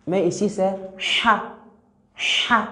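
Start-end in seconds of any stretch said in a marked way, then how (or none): 2.1-2.7 "Cha" said with a smooth, soft breathed h, no throat scrape; smooth soft letter sound. Shown as a demonstration. Teacher drilling students on the smooth soft "Cha". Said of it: "il y a le son qui vient".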